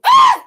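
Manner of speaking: high, loud mock yelp; imitated startled scream. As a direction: act out the yelp of nearly falling. Acting out almost falling at school.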